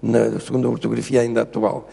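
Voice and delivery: normal talking voice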